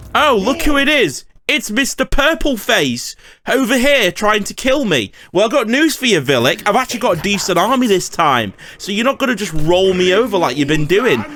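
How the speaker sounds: high, cocky "look who it is!" to the rival; mocking taunt. Real, not acted. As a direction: goad the enemy to bring it on. Taunting rival lord Villic.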